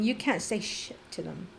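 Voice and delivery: usual speaking voice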